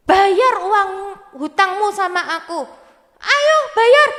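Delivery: tinggi dan keras, mendesak; marah. Acted. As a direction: desak dia membayar utang. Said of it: "marah-marah adik-adik". (membentak menagih utang)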